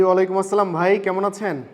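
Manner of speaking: ordinary talk, nothing marked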